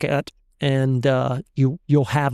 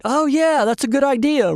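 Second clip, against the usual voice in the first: high, keen mock agreement; impressed agreement; 8 semitones higher than usual. Nodding classmates warming to the idea, impressed agreement. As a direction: imitate a classroom catching on.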